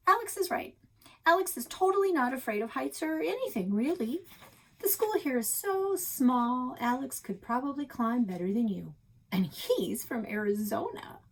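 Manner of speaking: high, teasing voice; mock teasing girl. A narrator's reading. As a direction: needle him sweetly. Girl teases Quincy, voiced high.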